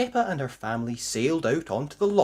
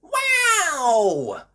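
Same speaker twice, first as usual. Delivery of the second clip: high, loud gasped wow; amazed wow; 7 dB louder than usual. Acted. Family seeing the monster, high loud wow. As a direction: gasp in wonder. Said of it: "gasped Peppa, Daddy and Mummy Pig".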